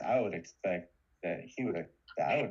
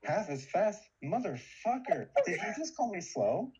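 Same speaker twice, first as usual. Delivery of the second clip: mock outrage; 11 semitones higher than usual. Mock outrage at being called slow.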